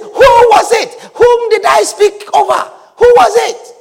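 high, urgent cry of dismay; shaken Isaac. Acted. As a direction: demand it in agitated disbelief. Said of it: "the old man was shaking, trembling uncontrollably".

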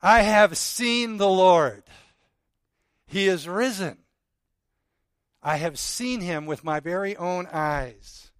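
loud and slow, every word held up; joyful proclamation. Acted. Mary, back from the tomb, tells the disciples in a loud, slow proclamation. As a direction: announce it like the greatest news ever.

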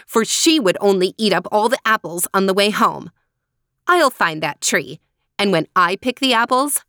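headstrong, scolding daughter; indignant and defiant; bossy Helena. Acted.

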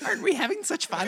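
high sarcastic laughing voice; mock chipper, high and laughing; sarcastic high cheer.

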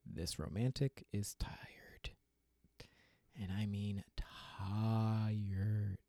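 slow, hushed title; dramatic whisper. Acted. Host announcing rant title in slow weary whisper.

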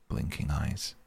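ordinary delivery, nothing marked

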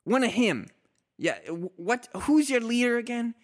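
high, flustered question; high confused voice. Acted. Character asks in high voice.

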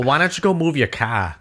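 put-on casual New England accent. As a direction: drop the R's like a New Englander, "car" as "cah". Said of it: "No noticeable R's".

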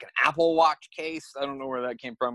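ordinary delivery

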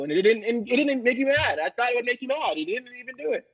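ordinary delivery

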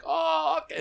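high, drawn-out mock "oh"; dawning realization. Italian driver grasping he is learning, high imitated realization. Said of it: "he would be like".

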